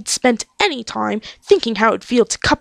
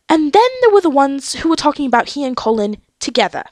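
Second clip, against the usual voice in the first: ordinary delivery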